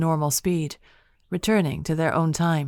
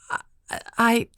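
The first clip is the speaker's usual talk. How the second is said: stammering; 6 semitones higher than usual. A narrator's reading. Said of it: "Kate stammered".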